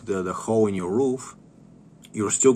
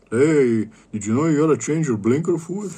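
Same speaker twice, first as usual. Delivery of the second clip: higher, louder, fast mock-innocent voice; teasing prank line; 5 semitones higher, 7 dB louder than usual. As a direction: sell the fake tip with a playful grin. Mocking the blinker fluid prank.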